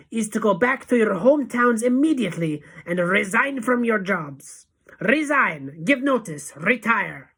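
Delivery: high, loud orders, each verb separate; Grand High Witch thundering. A narrator's reading. Witch leader ordering followers to resign, thundering. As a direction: thunder out the commands. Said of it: "thundered the Grand High Witch".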